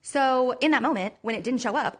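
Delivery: usual speaking voice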